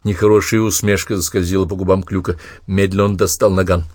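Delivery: ordinary delivery, nothing marked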